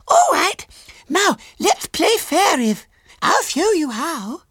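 put-on very high little girl voice; Violet Elizabeth. A narrator's reading. Small girl questions her visitor, high and childish.

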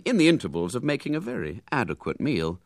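ordinary delivery

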